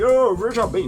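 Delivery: ordinary delivery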